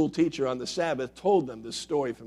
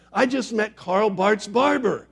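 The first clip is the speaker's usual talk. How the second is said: higher, louder, slow and thrilled; excited; 7 semitones higher, 6 dB louder than usual. A narrator's reading. Tourist telling his friends, thrilled and loud. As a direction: burst with the big news. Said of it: "excitedly told all his friends".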